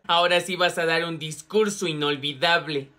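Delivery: very low, plotting villain voice; scheming prankster. Acted. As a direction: plot the prank in a deep, sinister tone. Emilio trama una broma a Regina. (voz grave de villano tramando una broma)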